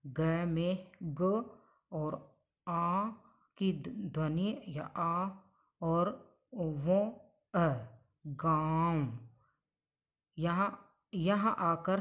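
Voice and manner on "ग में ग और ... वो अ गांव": गांव split very slowly into ग, आ, अ; slow sound breakdown. Shown as a demonstration. Teacher spelling out गांव for students, very slow. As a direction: stretch out each sound of गांव. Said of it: "शब्द है गांव".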